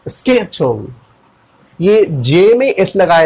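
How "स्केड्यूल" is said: "स्केड्यूल" said as sked-jool; "schedule" with sk. Shown as a demonstration. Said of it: "आप कैसे बोलेंगे?".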